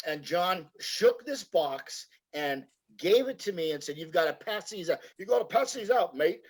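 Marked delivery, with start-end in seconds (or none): 4.0-5.0 John's accent, lightly imitated and kept soft; a light hint of John's accent, handing the job over. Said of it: "in his accent". 5.2-6.4 John's accent repeated higher, lightly imitated. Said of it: "in his accent".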